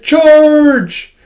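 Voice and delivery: high, loud, drawn-out charge; playful battle cry. Retold or imitated. Child playing chase with her dog, playful battle cry. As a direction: yell it like a kid leading an attack.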